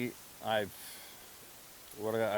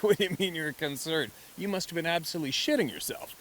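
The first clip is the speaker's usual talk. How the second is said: amused disbelief; 7 semitones higher, 4 dB louder than usual. Retold or imitated. Teasing grandfather in disbelief.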